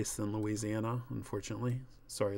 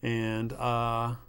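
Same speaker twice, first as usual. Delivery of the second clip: ordinary delivery, nothing marked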